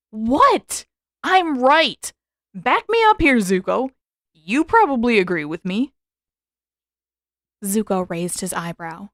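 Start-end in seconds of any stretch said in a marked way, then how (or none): 0.1-5.9 loud, higher defensive protest; mock indignant appeal to a friend; a little defensive. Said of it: "turned a little defensive".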